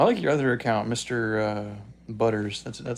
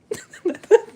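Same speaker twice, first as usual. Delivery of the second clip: loud high laugh; 11 dB louder than usual. Real, not acted. Laughing at own impression.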